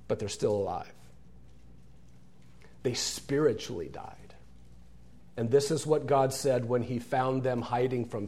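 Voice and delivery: ordinary talk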